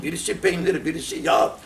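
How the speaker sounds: ordinary talk, nothing marked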